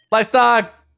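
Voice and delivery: loud high mock despair; frustrated yell. Real, not acted. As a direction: wail it in comic despair. After failure.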